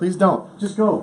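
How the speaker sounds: desperate little plea to back off; pleading. Acted. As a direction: beg them to leave. Begs intruder not to enter.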